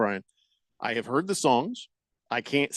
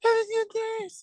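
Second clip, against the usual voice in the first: winded rock singer's high, strained wheeze; mock an out-of-shape rocker gasping between phrases. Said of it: "imitation of [name]".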